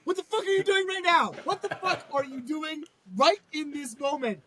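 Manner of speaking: high-pitched outraged rant; incredulous scolding. Pretend. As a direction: scold her in comic disbelief. Scolding the character directly.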